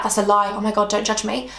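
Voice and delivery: normal talking voice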